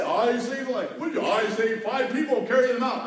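mock heroic boast. Firefighter jokingly boasting of rescues, hammed-up and loud. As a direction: puff up like a hero for a laugh. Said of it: "I can't say".

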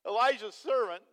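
normal talking voice

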